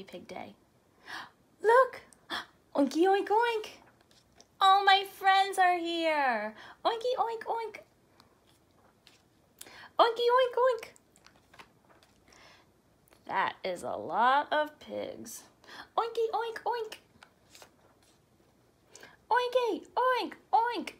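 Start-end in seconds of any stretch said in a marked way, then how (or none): 1.6-10.9 high squealy oinking; a crowd of cheerful pigs oinking; pig chorus. Acted. Pigs at the party greeting each other, squealing. 16.0-20.9 high squealy oinking; a crowd of cheerful pigs oinking; pig chorus. Acted. Pigs at the party greeting each other, squealing.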